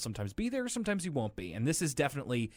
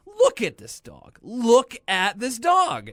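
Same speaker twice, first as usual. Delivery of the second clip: exaggerated outrage; 11 semitones higher, 10 dB louder, slower than usual. Mock outrage at the dog.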